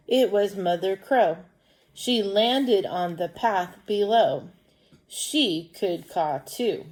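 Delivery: slow, deliberate story reading; children's story read-aloud. Acted. Teacher reading a crow story to pupils, slow storytelling. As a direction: read slowly and clearly for young pupils.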